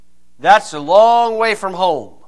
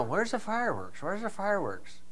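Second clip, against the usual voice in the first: hushed wondering; 3 semitones higher, 13 dB quieter than usual. Retold or imitated. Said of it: "And we're kinda".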